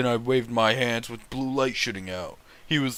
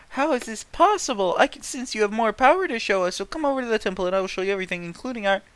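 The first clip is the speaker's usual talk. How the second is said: higher, rushed mock girly voice; Sakura's voice; 8 semitones higher, much faster than usual. Reading Sakura's plea in a bad fanfic, higher and rushed. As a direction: play Sakura as an eager, hammed-up schoolgirl. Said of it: "Sakura said".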